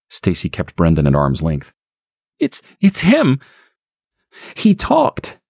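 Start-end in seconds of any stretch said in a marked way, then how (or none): 2.4-5.4 high, halting, slower frightened blurt; panicked mother. Acted. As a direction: stammer out the shock. Stacy panics to Harry.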